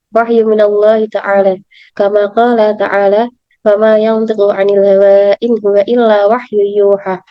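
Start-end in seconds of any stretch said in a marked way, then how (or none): none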